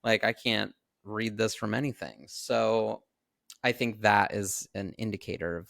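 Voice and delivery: ordinary talk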